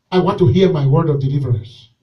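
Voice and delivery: ordinary delivery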